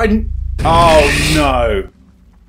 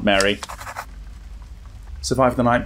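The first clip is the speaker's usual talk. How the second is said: ordinary talk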